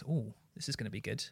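soft, quick, half-whispered anticipation; quiet excited aside; muted mock hype. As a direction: murmur it to yourself, rushed.

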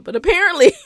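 loud, high laugh breaking through. Real, not acted. Laughing as she recalls the gel story.

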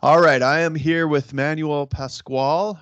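ordinary delivery

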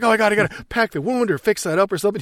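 imitated freak-out. Reporter fussing over the wound, imitated panic. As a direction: rattle it off in mild pretend panic. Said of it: "she's freaking out".

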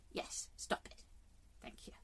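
whispered "stop it" to the cat; a real whispered telling-off to her cat; hushed scolding. Real, not acted. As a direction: shoo the cat off under your breath, away from the mic.

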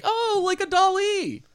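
mock friends. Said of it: "and his friends were like".

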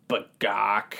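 low deadpan chicken cluck; clucking like a hen. Shown as a demonstration. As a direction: cluck it straight to camera. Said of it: "Say that straight into the camera".

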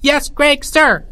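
high, squeaky little subordinate; small voice. A narrator's reading. Third-grade leader answering his general, small voice. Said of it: "said a small voice".

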